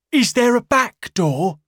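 high, loud anxious cry; frantic question. Acted. Marvel asking the barman, panicked. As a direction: ask in a panic. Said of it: "asked Marvel".

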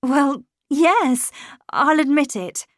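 laughing admission. A narrator's reading. Governess admitting it to Miles, mildly laughing. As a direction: admit it with a touch of amusement. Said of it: "laughing myself".

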